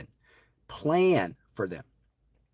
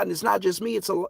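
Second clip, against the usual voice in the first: normal talking voice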